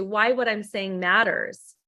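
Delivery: ordinary delivery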